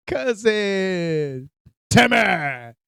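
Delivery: loud drawn-out calls of names; mock calling out. Calling cousin Timmy among zombies, mock holler. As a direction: holler the names, stretched and hammed-up.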